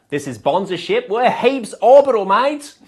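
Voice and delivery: hammed-up Aussie astronaut on the radio; Australian accent. Australian astronaut radioing ground control in broad Australian accent. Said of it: "The Aussies are here".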